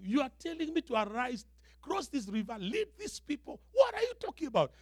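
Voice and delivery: high, rising disbelief; incredulous Joshua. Acted. Joshua questioning God's order to cross the river, incredulous. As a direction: protest in mounting bewilderment. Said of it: "So Joshua must be wondering".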